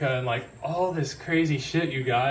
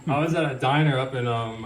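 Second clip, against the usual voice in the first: ordinary talk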